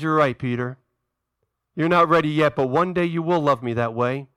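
low, tender acted reassurance; gentle reassurance. Voicing Jesus gently reassuring Peter.

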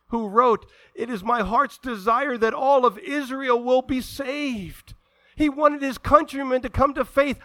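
loud, high preaching at full force; fiery sermon climax; impassioned preaching. Acted. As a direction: drive it home to the congregation.

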